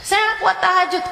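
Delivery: high, whining excuse; whiny complaint. Retold or imitated. A follower whining to the ustazah about fasting, high-pitched. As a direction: whine like a lazy student. Voiced complaint, higher, fast.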